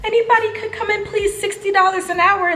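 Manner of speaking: squeaky overeager recruiter voice; mock job posting. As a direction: ham up a desperate hiring ad. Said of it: "talking about some".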